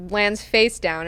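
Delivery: normal talking voice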